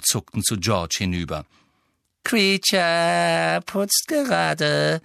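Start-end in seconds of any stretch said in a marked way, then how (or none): 2.2-5.0 high, very slow, drawn-out creature whine; evasive elf. A narrator's reading. Elf claiming to be cleaning, evasive. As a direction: stall with long stretched vowels, high and evasive. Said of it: "sagte er ausweichend".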